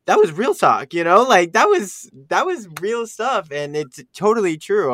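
loud, high and thrilled; adrenaline rush. Real, not acted. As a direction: burst out with excitement. Excited reaction, "that was intense.

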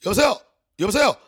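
loud, high Korean "hello?" into the phone; hammed-up gate guard bellowing the phone greeting; phone-answering routine. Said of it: "when they answered the phone".

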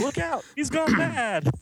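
high, slow mock alarm; alarmed warning. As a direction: shriek the warning like a comic-book bystander. Redoes the warning line.

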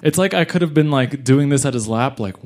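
ordinary talk, nothing marked

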